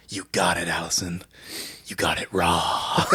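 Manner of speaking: hushed low movie-star purr; sultry line read. Retold or imitated. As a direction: drop into a low seductive purr. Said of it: "only way you can read that line".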